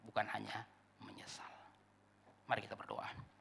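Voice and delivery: soft, breathy whisper into prayer; whispering to the congregation before praying; hushed whisper. Acted.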